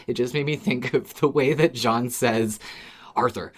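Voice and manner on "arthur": ordinary talk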